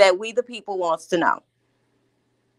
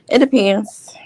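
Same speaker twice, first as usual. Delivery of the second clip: sassy; slower than usual. Shown as a demonstration. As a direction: say it with sass, flipping your hair. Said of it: "as I put my invisible hair behind my ear".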